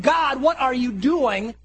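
loud, high, drawn-out appeal; pleading father. Acted. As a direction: cry out the question to heaven, slowly. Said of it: "And Dad is saying".

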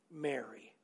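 soft, low, tender calling of her name; a quiet, loving name. Retold or imitated. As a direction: say her name gently, as Jesus would. Jesus gently calling Mary's name.

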